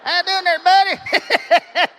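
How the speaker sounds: high, loud hammed-up American twang; mock a loud American; American accent as heard by the English. How Americans sound to English ears, hammed-up American. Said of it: "They sound like this right here".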